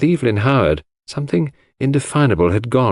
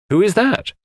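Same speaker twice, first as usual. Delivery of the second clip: sharp; 12 semitones higher than usual. A narrator's reading. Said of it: "I asked sharply".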